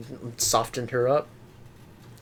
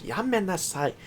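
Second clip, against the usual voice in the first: scolding; 7 semitones higher, 4 dB quieter than usual. Shown as a demonstration. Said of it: "like I'm scolding them".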